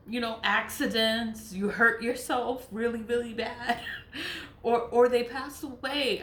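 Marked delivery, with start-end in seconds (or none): none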